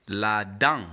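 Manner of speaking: "la dent" as la-don, nasal vowel, silent t; "la dent" in careful French with nasal ending; French model pronunciation. Shown as a demonstration. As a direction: model "la dent" clearly for a learner.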